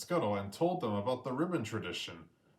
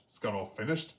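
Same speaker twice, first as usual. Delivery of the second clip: usual speaking voice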